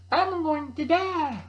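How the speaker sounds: high, slow mock wail; panicky camper. Campers panic in the woods, high drawn-out mock wail. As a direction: wail like a helpless city camper. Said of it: "you're like".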